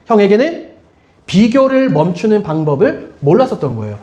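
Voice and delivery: normal talking voice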